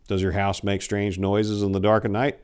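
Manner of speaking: ordinary delivery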